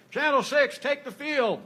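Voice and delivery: high-pitched, commanding referee call; forceful referee voice. Retold or imitated. Referee ordering a late team out, forceful and high. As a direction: call it out like a ref starting play. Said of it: "in my very forceful referee voice".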